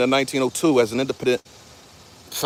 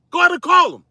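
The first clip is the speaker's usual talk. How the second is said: louder angry dare; 14 semitones higher, 4 dB louder, faster than usual. Real, not acted. Man shouting a dare after the retreating woman, louder. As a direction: repeat the dare, louder and higher.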